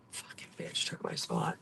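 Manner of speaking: angry whispered swearing under the breath; real muttered fury at a stolen spot; whispered curse. Real, not acted. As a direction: hiss it to yourself, furious but unheard. Stolen parking spot, cursing alone.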